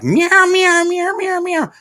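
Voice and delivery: high, loud mock meowing; imitated cat yowls, well above the voice; cat meows. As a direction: meow like a frantic cat. Imitating cats going crazy.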